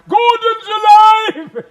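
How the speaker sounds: slow, huge roaring bellow; booming shout. Retold or imitated. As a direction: roar it to wake the building. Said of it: "Please say it".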